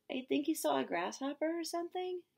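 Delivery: put-on high, light kid voice; high kid voice, casual; little boy's voice. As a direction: pitch up into a kid shrugging it off. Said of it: "the little boy says".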